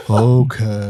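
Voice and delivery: dopey slow big lug; stupid voice. Acted. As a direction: drawl it like a dumb oaf. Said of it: "do the stupid voice".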